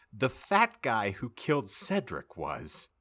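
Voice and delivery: ordinary talk, nothing marked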